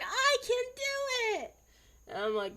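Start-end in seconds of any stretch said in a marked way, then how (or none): 0.0-1.5 very high, loud, drawn-out mock cheer; triumphant kid. Kindergartner back from across the hall, mock triumphant shout. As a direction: squeal it out like a proud little kid. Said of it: "He came back going".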